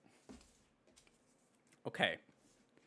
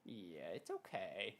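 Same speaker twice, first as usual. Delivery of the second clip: low, soft reassurance to the dog; calm the frightened dog; soothing pet; 6 semitones lower, 14 dB quieter than usual. Real, not acted.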